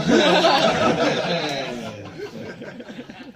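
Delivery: loud high laugh breaking out; big burst of laughter. Real, not acted. Roast joke lands, big laugh.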